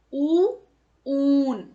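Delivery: ऊ and ऊन said loudly, the ऊ held long; stretched, stressed long oo. Shown as a demonstration. Teacher drilling children on the long ऊ, loud and stretched. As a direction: pull out the long ऊ with force. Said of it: "बड़े ऊ को हम जोर देकर थोड़ा सा उसको खींचकर".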